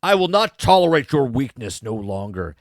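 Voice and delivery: mock Vader line. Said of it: "And she's like".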